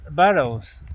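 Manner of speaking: ordinary delivery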